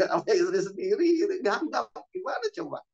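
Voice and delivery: high-pitched, amused disbelief through laughter; laughing incredulously. Real, not acted. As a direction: laugh while questioning it. Menertawakan kegagapan pada istri sendiri. (tertawa heran)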